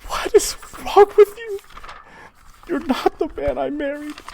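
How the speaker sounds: very high falsetto, breaking with dismay; hammed-up anguished wife; distressed mother. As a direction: plead with your husband in a woman's voice. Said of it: "And she's like".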